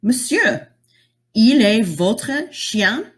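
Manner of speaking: "Monsieur, il est votre chien?" slow, rising, polite; polite French question. Shown as a demonstration. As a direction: ask an adult politely, slowly in French. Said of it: "our words are going to change".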